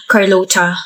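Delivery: ordinary delivery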